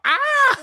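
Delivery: high loud falling scream; Wilhelm scream. Retold or imitated. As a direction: belt out the stock movie scream. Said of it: "It's the Willem scream".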